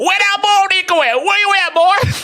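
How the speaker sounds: very high, hollered Texan drawl; badly faked Texan cowboy calling someone out; mock Texan accent. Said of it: "that's not Texas, Texan accent".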